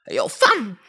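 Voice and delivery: high, loud Toronto "yo fam" call; Toronto accent. Acted. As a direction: shout it like a Toronto kid. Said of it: "how did Toronto accent?".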